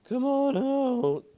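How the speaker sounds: high, drawn-out call into the hole. Acted. Shouting into the hole.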